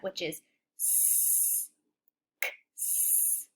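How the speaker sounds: s as a long hiss, then k and s whispered; s sound. Shown as a demonstration. Teacher splitting x into k and s, softly hissed. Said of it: "the sound that S can represent".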